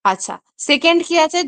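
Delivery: ordinary talk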